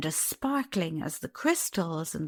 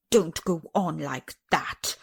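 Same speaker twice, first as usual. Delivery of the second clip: plain reading voice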